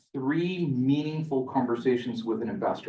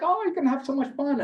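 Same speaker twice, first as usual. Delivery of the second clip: much higher, chirpy reply; cheerful host; 14 semitones higher than usual. Retold or imitated. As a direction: play the expat cheering him on. Expat replies cheerfully.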